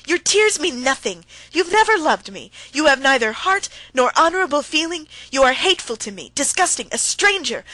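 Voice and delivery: loud, high and bitter, building; furious wife denouncing her husband; heated fury. A narrator's reading. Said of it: "getting more and more heated".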